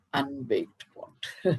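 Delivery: slow, soft, hesitant reluctant verdict. A narrator's reading. Potter hesitantly tells Namdev he is unbaked, soft and slow. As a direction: drag it out, afraid to say it. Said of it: "very hesitantly".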